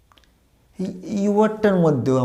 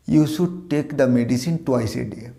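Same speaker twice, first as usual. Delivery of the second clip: slow model sentence; much slower than usual. Shown as a demonstration. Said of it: "model sentence read slowly".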